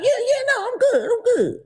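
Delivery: very high, comic denial; mock insisting. Angry-faced man claiming he is fine, mock insisting. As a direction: insist it's fine in a squeezed-high pitch.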